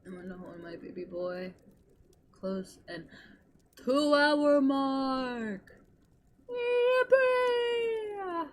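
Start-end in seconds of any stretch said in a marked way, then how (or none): 3.8-8.5 excited shout. Real, not acted. Celebrating a stream milestone.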